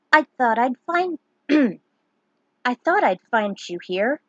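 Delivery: a hint of a friendly young man; young man's voice. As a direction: greet her warmly as an old friend. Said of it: "It was Penello".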